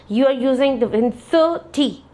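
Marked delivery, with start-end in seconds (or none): 1.1-2.0 "thirty" said slowly, first syllable stretched; prolonged first syllable. Shown as a demonstration. Teacher demonstrating thirty for test students, first syllable prolonged. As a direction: hold THIR in "thirty", then a short "ty". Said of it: "the first syllable is prolonged".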